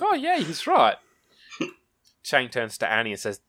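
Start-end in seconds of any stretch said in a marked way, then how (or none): 0.0-1.0 high, excited mock agreement; a classmate caught out, voiced high and bright. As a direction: pitch it way up as a dim classmate. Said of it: "Shell's like".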